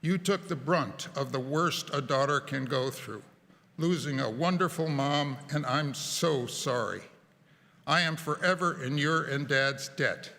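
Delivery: ordinary talk, nothing marked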